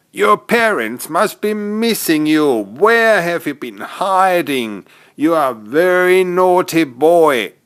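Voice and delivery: high, mock-stern Santa voice; tut-tut like a fond Santa; Santa's mild scolding. Santa gently scolding the runaway Joey, mock-stern and high.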